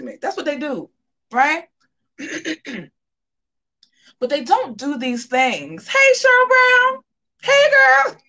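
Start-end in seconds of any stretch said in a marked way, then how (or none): 5.9-8.2 high, loud, drawn-out hello; squealed greeting to a viewer; high sing-song greeting. Acted. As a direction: sing out a bright hello to a friend. Greeting a caller by name.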